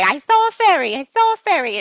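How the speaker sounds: put-on high, loud thrilled cry of a child who saw a fairy; thrilled shout repeated; excited child. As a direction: squeal it out with wonder. Seeing a fairy.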